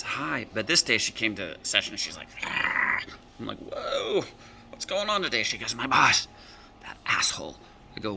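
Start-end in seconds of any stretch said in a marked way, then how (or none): 2.4-3.0 rough, voiceless groan of frustration; exasperated growl. Retold or imitated. Client arrives upset about her boss. 3.6-5.4 high-pitched, startled reaction; taken aback. Retold or imitated. Coach reacts to upset client. 5.7-7.6 imitated outburst, then a voiceless hiss; venting anger. Client vents angrily about her boss.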